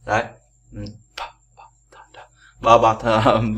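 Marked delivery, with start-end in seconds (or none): 1.2-3.5 "p, th" thì thầm, chỉ có hơi; thổi hơi ra, không dùng giọng; thì thầm âm gió. Shown as a demonstration. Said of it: "Thì nó là p". (thì thầm các âm bật hơi)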